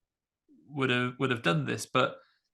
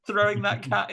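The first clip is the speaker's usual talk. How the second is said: high, louder incredulous question; mock astonishment; 8 semitones higher, 5 dB louder than usual. Teenage viewer spotting stagehands on paused video, mock astonished. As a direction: pitch it up in mock disbelief. Reenacts squinting at paused film.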